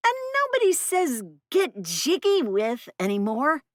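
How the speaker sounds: put-on high, scornful teen voice; sassy teen girl. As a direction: roll your eyes at it. Teen voice on phone.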